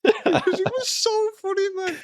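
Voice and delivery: high, squeaky real laughter through the words; can barely get it out for laughing; laughing while talking. Real, not acted. Cracking up at his own memory.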